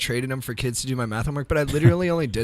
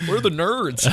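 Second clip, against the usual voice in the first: put-on high and loud, hamming up a cocky kid; mock schoolyard bully calling out; playground bully; 8 semitones higher, 4 dB louder than usual. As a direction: strut in looking for victims. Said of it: "I was like".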